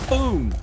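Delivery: loud high shouted boom; explosion boom. Real, not acted. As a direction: yell boom like a blast. Explosion sound effect.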